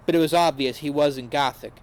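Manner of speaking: ordinary delivery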